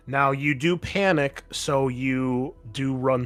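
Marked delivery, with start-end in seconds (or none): none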